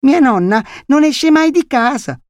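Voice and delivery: put-on very high, cocky little boy; know-it-all child. A narrator's reading. Boy informing visitor about grandmother, high know-it-all tone. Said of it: "in tono saccente".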